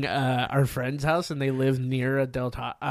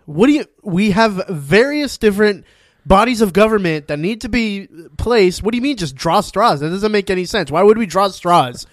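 incredulous; 6 semitones higher, 6 dB louder than usual. Retold or imitated. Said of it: "he's like".

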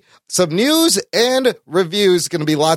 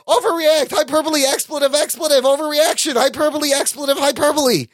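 overreacting rant; 9 semitones higher than usual. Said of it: "all you gotta do is go".

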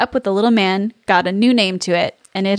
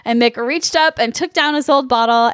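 ordinary talk, nothing marked

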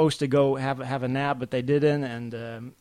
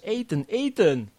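put-on high, louder toddler whine; whining toddler in Dutch; 6 semitones higher, 5 dB louder than usual. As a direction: beg like a hungry little boy. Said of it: "he says that Dutch".

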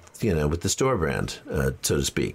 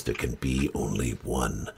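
low, deep, dramatic Scottish-tinged intonation; Highlander voice; 9 semitones lower, 5 dB quieter than usual. Retold or imitated. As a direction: intone it like the immortal swordsman. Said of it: "I'm gonna slip into my Highlander voice".

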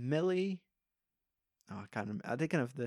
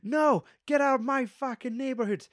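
higher, louder angry yelling; drunken rant; 10 semitones higher, 4 dB louder than usual. Retold or imitated. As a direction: run them off the street. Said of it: "telling you, it's like".

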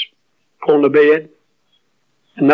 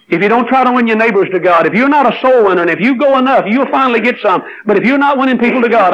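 preaching; 5 semitones higher than usual. Acted. Preaching builds.

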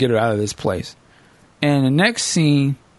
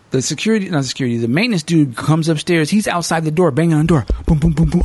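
usual speaking voice

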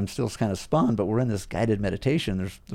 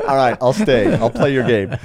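laughing, louder give-in; 3 semitones higher, 7 dB louder than usual. Real, not acted. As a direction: laugh it off and agree. Laughing while giving in.

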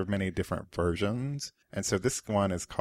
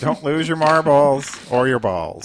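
teasing; 6 semitones higher, 11 dB louder than usual. Real, not acted. Joking warning.

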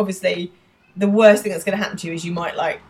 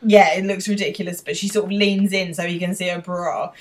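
ordinary delivery

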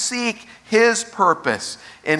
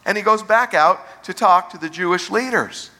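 normal talking voice

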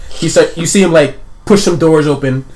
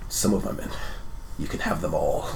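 very quiet, low voice; stone cold; 8 semitones lower, 13 dB quieter than usual. Acted. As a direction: grant it in a cold, hushed tone. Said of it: "face turns stone cold".

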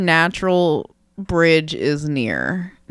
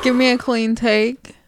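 normal talking voice

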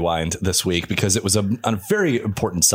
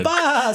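high squeaky cartoon laugh burst; SpongeBob laugh. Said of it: "SpongeBob laugh also".

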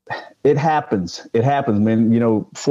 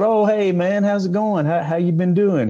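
much higher, overly chummy hello to hide the confusion; fake cheerful greeting; 9 semitones higher than usual. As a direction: play it up, bright and friendly to a stranger. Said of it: "I said".

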